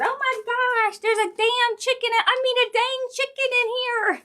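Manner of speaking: high, loud startled shriek; retold scream. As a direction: shriek it like a chicken just ran in. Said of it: "she screamed and said".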